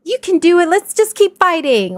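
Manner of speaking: high, loud pep-talk voice; mock cheerleading. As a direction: chirp it like an overeager cheerleader. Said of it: "I hate the whole".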